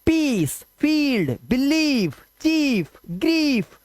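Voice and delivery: high, drawn-out ee in पीस, फील्ड, बिलीव, चीफ, ग्रीफ; long E sound. Shown as a demonstration. Teacher showing the long vowel sound, ee held long in पीस, फील्ड, बिलीव, चीफ, ग्रीफ. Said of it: "ई का sound लिया, long vowel sound".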